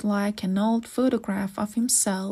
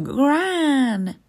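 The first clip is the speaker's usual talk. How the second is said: loud high call of Gran; shout; 5 semitones higher, 6 dB louder than usual. A narrator's reading. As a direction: shout her name to stop her. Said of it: "shouted Mom".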